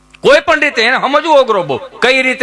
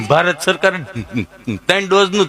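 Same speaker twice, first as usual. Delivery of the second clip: low, dragged-out stammering answer; 4 semitones lower than usual. Retold or imitated. To the nurse, Gabho answers his name in a low stammer.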